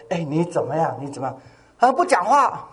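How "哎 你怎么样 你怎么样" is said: gentle asking. Retold or imitated. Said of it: "我就说".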